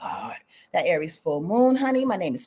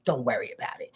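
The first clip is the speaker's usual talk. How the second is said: lower, softer calm protector voice; reassuring Capricorn; 6 semitones lower, 6 dB quieter than usual. Acted. Reader voicing Capricorn calmly reassuring the Virgo. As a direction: soothe like a steady guardian. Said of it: "Capricorn says".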